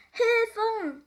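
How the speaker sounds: high, loud cry for help; big drawn-out distress call. A narrator's reading. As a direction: shout for rescue, storybook style. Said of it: "rief Tiefsee Doktor Theodor".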